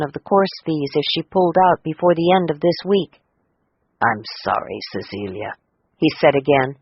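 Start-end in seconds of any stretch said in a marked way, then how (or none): 4.0-5.5 much lower and softer, sorry; low, hushed regret; soft apology. A narrator's reading. Teacher apologizes to Cecilia, low and soft.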